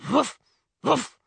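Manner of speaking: two high, loud woofs; dog barking. A narrator's reading. Bewitched Kate interrupting her parents, two barks. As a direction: bark twice, short and sharp. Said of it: "nothing but bark".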